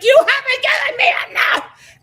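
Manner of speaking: high, loud shouted line; retelling Haley yelling at Blake, high and loud; mock shout. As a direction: shout it back at him.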